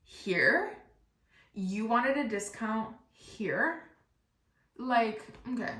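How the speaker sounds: drawn-out disbelief, rising at the end; mock incredulous question, very slow. Tire guy questioning her discount request, incredulous.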